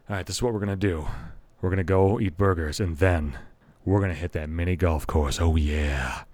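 low, mock-suave smooth operator; smooth charmer. To his date, a mock smooth-talking charmer's low purr. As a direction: purr out the plan like a lady's man. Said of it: "I wasn't sly and smooth enough".

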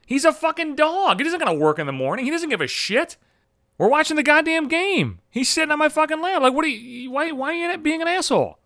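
high-pitched, acted exasperated arguing; indignant protest. Son arguing with mom over taking the dog, acted indignation.

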